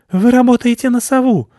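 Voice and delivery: very high, loud squeaky alarm; shrill panic of startled bats. A narrator's reading. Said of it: "заволновались мыши".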